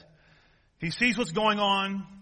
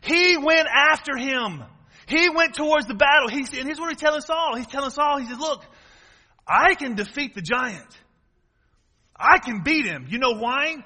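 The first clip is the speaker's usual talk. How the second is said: louder, raised voice, punching each phrase; fervent preaching; 6 semitones higher, 5 dB louder than usual. Real, not acted. Preacher tells congregation how David really fought the bear, fervent preaching. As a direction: preach the fight with mounting force.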